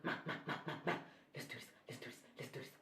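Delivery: hushed pep talk to herself; low, barely audible muttering; whispered self-talk. Retold or imitated. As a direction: whisper it, psyching yourself up. Retelling psyching herself up.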